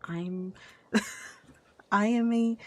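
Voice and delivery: ordinary talk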